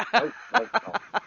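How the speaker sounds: high, sing-song nopes; playful nope. Real, not acted. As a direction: toss off a cheeky refusal. Playful refusal, high pitch.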